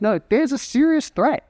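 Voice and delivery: high, frantic and indignant; panicked dog, half laughing. Retold or imitated.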